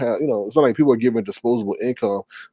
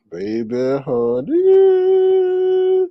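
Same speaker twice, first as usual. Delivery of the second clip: soaring, held final word; drawn-out catchphrase; much slower than usual. Pretend. Host reading a viewer comment, drawn-out catchphrase. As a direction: glide way up and hold the note.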